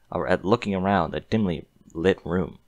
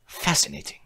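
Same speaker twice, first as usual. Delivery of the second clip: breathy, higher fascination; soft fascinated whisper; 8 semitones higher, 3 dB quieter than usual. A narrator's reading. Doctor pony studying the human, whispered fascination. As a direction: breathe the word in wonder. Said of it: "he simply said".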